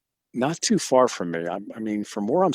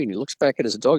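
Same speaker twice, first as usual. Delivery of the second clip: usual speaking voice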